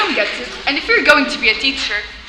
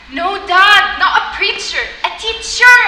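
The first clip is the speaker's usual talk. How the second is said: "preacher" set against a louder, higher "teacher"; emphatic correction; 5 semitones higher, 7 dB louder, slower than usual. Acted. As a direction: correct Dad firmly, punching "teacher" high. Daughter corrects father's misunderstanding.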